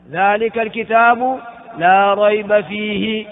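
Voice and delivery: slow, drawn-out chanted recitation; slow melodic Quran chant; Quranic recitation. Shown as a demonstration. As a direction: intone the verse slowly like tajwid.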